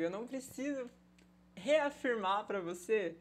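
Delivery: ordinary delivery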